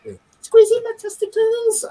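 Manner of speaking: squeaky high mocking falsetto; high pitched squeal. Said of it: "and then high pitched".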